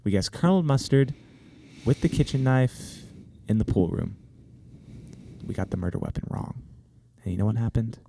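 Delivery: low, hushed confession with pauses; solemn storytelling. Pretend. Player confessing childhood Clue disaster, solemn storytelling. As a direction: draw out the tale gravely and softly.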